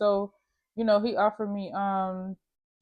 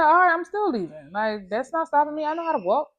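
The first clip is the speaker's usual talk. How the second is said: defiant outburst; 8 semitones higher, 5 dB louder than usual. Retold or imitated. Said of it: "So I said".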